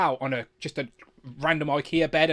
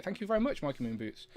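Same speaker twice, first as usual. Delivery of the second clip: softer, dropping thanks; quiet; 10 dB quieter than usual. Real, not acted. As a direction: say it again under your breath, falling. Thanking a donor twice.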